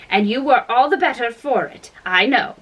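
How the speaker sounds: plain reading voice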